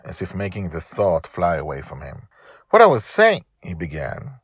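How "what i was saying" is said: high, loud restart; flustered father. Acted. Dragon Father picking his words back up, high and loud. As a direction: cover the slip quickly.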